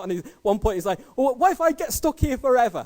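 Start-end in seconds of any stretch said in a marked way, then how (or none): 1.1-2.8 high, loud mock panic; panicking, spinning out. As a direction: act out a learner driver losing his head. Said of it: "he's proper spinning out".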